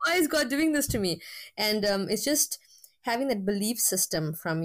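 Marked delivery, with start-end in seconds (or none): 0.1-1.2 pitched high, pleading why to the sky; exasperated inner cry. Retold or imitated. Teacher with a stutter recalls her frustration, high pleading voice. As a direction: cry out to God in mock despair. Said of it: "sometimes you think".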